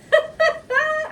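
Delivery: high burst of laughter; laughing. Real, not acted. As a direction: let out a loud laugh. Laughs while finishing grating.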